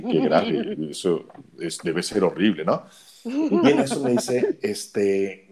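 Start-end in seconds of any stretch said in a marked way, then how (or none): 0.1-2.8 laughing retelling. Retold or imitated.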